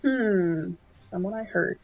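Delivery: normal talking voice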